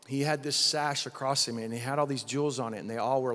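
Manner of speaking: ordinary talk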